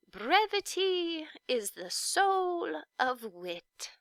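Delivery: slow, grand reading of a motto; proclaiming the sign. Acted. The witch recites her palace sign, slow and grand. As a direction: announce it like a royal decree.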